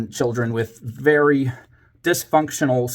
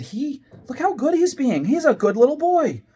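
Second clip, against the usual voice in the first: very high, gushing mock praise; exaggerated high-pitched teacher praise; put-on praise; 14 semitones higher than usual. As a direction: act hugely pleased with a well-behaved child. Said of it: "I kept on with the act".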